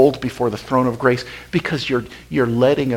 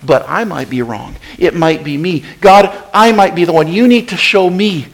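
raised, urgent plea to God; pleading prayer; 6 semitones higher, 8 dB louder than usual. Acted. Believer confessing to God that he might be wrong, raised and urgent. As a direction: beg God for help, pressing hard. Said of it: "come back around and say".

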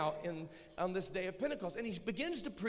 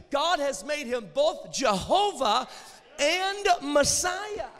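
loud, high, climbing preacher's cry; full-force preaching; 7 semitones higher, 11 dB louder than usual. Acted. Preacher proclaiming to the crowd, loud and rising. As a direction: thunder it to the crowd like Peter.

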